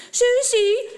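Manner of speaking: high, loud call of a name; children calling for the lost dog, loud and high. A narrator's reading. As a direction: call the lost puppy across the woods. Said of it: "roepen ze".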